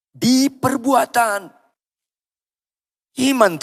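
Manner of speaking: high, drawn-out insistent call; emphatic preacher's rallying answer. Shown as a demonstration. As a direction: raise it high and stretch it out. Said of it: "Lebih keras".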